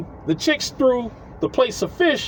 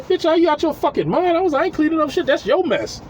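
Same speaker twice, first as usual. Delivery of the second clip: pitched-up mock outraged refusal; imitating his own fed-up comeback, higher; indignant retort; 8 semitones higher than usual. Telling the neighbor he won't clean her mess, indignant and higher. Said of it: "I said".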